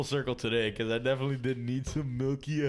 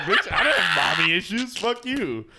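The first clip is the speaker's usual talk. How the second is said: mock outraged rant; 8 dB louder than usual. Retelling a comeback to [name], mock outraged and loud. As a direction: fire back at her, offended and loud. Retelling her angry reply.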